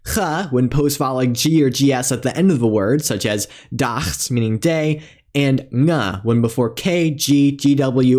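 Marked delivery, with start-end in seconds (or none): 0.1-0.2 "Ch" as in loch, with an ah after it; voiceless throat fricative. Shown as a demonstration. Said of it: "pronounced in four different ways". 5.7-6.2 "nga" starting on the ng of sing; velar nasal. Shown as a demonstration. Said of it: "pronounced in four different ways".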